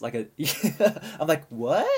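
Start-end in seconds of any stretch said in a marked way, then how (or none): 1.5-1.9 put-on surprise, breaking into a laugh; feigned surprise. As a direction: act as if you had no idea. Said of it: "I act surprised".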